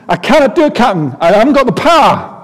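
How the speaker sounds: put-on high, loud Scottish accent, exasperated. Engineer Scotty protesting to his captain, in an exasperated Scottish accent. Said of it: "Scotty replies in an exasperated Scottish voice".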